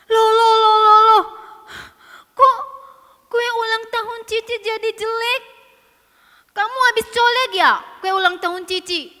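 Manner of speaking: very loud, high outraged alarm; shocked accusation. Acted. Sister finding her birthday cake spoiled, loud and outraged. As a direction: cry out in outrage, then accuse.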